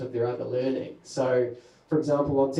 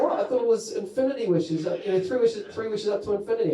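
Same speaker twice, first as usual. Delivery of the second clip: mock indignant; 6 semitones higher than usual. Indignant mock complaint to genie.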